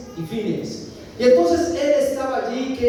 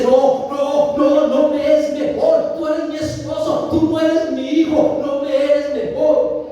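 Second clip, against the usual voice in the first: louder and higher, firm refusal; Ana's raised protest; 5 semitones higher, 3 dB louder than usual. Acted. Ana answers her husband with a loud, insistent refusal. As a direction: push back hard, no no no.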